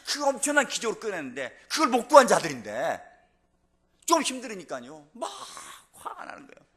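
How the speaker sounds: high, loud sermon cry; impassioned preaching. Acted. Preacher mimicking Israel's complaints to the congregation, impassioned. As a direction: preach the people's repeated complaints at full force.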